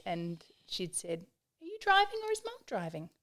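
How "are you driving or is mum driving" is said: calm fact-finding sister. Retold or imitated. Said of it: "just that calm, calculated fact finding".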